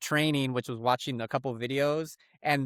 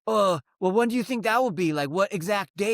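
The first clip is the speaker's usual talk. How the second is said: pushy salesman; 6 semitones higher, 3 dB louder than usual. Retold or imitated. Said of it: "And he goes".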